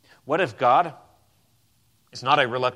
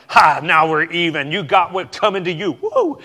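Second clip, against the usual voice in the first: louder gloat ending in a high whoop on woo; mock the petty score-settler; hammed-up gloating cheer; gloating revenge; 5 semitones higher, 9 dB louder than usual. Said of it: "avenge is not like".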